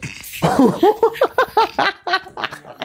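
long, high-pitched burst of laughter; high laughing fit. Real, not acted. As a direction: crack up helplessly, voice jumping high. Cracking up at a clip.

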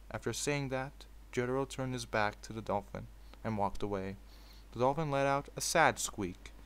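story narration. A narrator's reading. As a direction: read it like a bedtime fanfic. Reads fanfic aloud.

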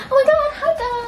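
high-pitched mock welcome at the door; hammed-up, high-pitched hello; gushing hostess greeting. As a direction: greet guests in a put-on, squealing hostess voice. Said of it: "opening the door and be like".

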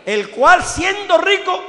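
"El cual siendo rico" shouted high and slow, stress on "cual"; shouted verse. Acted. Preacher proclaims the verse. ("El cual siendo rico" gritado, alto y lento)

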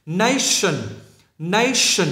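"Nation" with a clear hushing "sh" in the ending; đuôi đọc âm sh. Shown as a demonstration. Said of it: "phải phát âm là sh". ("Nation" đọc rõ âm "sh" ở đuôi)

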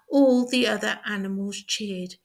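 usual speaking voice

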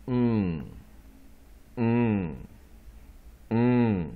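嗯 as a loud, held nasal ng hum; NG sound. Shown as a demonstration. Teacher demonstrating the NG digraph, sustained 嗯 as a pure ng. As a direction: hum 嗯 three times, loud and falling, with pauses. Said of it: "我们就念嗯".

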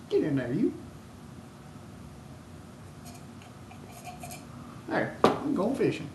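lightly voiced country bumpkin; a touch of high-pitched yokel; put-on hillbilly. Yokel getting a line in to go fishing, light hillbilly voice. Acted backwoods fishing bit continues.

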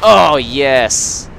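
loud triumphant yes; excited cheer. Real, not acted. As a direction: cheer the hit. Kills an enemy.

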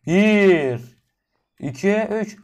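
tiz, gür sayım ve "bismillah" haykırışı; bağırarak geri sayım. Real, not acted. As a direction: kendini cesaretlendirerek bağır. Shouting countdown before jumping. (atlamadan önce gür, heyecanlı sayım)

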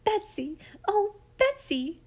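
high, panicked calling out; frightened scream. A narrator's reading. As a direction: wail the name in fright, pitched high. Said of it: "she screamed".

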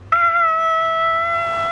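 high, loud mock scream; pretend scream. Child scripting the roleplay for dad, pretend scream. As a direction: scream like you're being taken. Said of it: "You just hear screaming".

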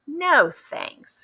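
a touch of grumpy, high; grumpy refusal. A narrator's reading. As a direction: refuse like a sulky duck. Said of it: "grumped Duck".

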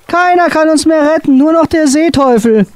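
high, lamenting voice; despairing wail. A narrator's reading. Sailor Mart moans only the sea devil can save them. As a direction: keep wailing without hope.

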